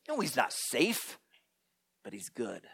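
low soft grave reply, fading to a hush; hint of Mister Beaver. Mister Beaver reassuring the children, soft and grave. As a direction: answer with quiet gravity.

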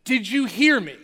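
dad shouts down the stairs a second time; dad yelling again. Retold or imitated. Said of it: "he would yell down again".

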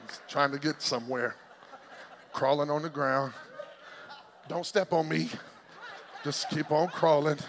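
low, small-creature plod with little pauses; lightly voiced caterpillar. Preacher plays the caterpillar inching along the ground, lightly voiced low. Acting out a slow crawling caterpillar.

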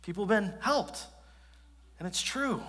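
humbled realization. Retold or imitated. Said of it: "he shared".

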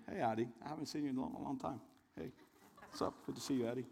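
soft, fast aside to someone in the room; quiet aside. Real, not acted. Preacher quietly greets Addie mid-sermon, fast and soft. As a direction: quickly greet a friend under your breath.

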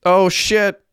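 high-pitched narrated shout; startled-sounding mock shout; shouted exclamation. A narrator's reading. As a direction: blurt it out high, like a contained shout. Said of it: "shouted Hecky".

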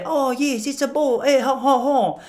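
high mock French with chortle; adopted French accent. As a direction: slip into a hammed-up French accent. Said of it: "start to take on their accent".

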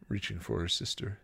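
usual speaking voice

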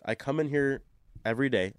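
ordinary talk, nothing marked

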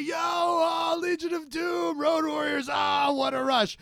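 high, loud fanboy holler; excited yelling. Retold or imitated. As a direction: re-enact yelling wildly at a stranger in the street. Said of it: "some guy was yelling at her".

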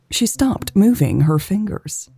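ordinary delivery, nothing marked

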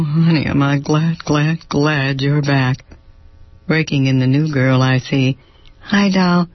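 a hint of a flamboyant man fussing over staff; flamboyant fashion man. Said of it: "And making his entrance is this tall fashion plate of a man".

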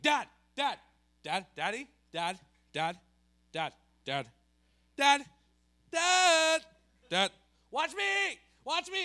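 put-on high, loud, repeated kid calls; nagging kid yelling for dad; pestering child. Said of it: "and you're like".

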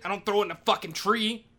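ordinary delivery

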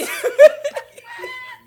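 very high, loud burst of laughter; howling laugh; shrieking laugh. Real, not acted. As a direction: scream with laughter. Laughing at tampon story.

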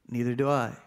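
ordinary delivery, nothing marked

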